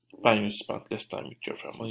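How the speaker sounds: usual speaking voice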